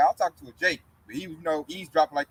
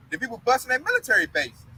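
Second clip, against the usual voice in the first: ordinary delivery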